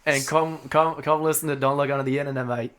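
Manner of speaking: clumsy mock Australian accent; bad Australian accent. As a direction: attempt a poor Australian accent. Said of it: "That's my worst fucking Australian accent".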